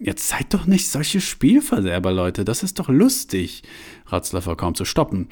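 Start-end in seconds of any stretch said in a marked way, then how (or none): 0.0-3.6 high, eager teasing of the group; enthusiastic. Acted. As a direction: egg your friends on gleefully. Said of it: "Johanna war ganz begeistert".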